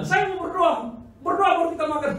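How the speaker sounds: high, loud order to put spoons down; loud command. Retold or imitated. Preacher ordering diners to drop spoons, loud command. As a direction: bark it across the dinner table.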